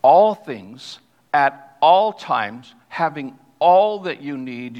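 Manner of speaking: preacher's slow emphatic reading; stress on all. Shown as a demonstration. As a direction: drag out every all in at all times, having all that you need. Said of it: "I think you heard me accent this".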